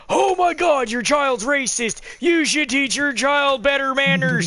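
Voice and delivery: high-pitched mock indignation; uber douchey outrage. Offended stranger to the parent of a kid, mock outraged. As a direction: scold like a self-righteous, offended stranger. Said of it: "people that are just uber douchey".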